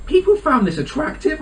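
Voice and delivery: high, slow mock disbelief; appalled, disbelieving question; incredulous reaction. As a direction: draw it out in high-pitched astonishment. Acted disbelief while reading extracts.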